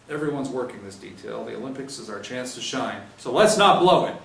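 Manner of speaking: rally the squad for the Olympics; rising sergeant briefing. Acted.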